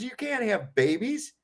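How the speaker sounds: lightly voiced fed-up rebel; exasperated objection. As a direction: stammer out disbelief. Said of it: "he goes".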